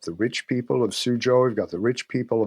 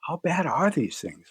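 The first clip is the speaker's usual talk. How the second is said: higher, anxious mock patient voice; worried patient; 6 semitones higher than usual. Patients panicking about the bitter herbs, higher and worried. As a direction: play the nervous patient asking how bad it is. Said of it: "They're going".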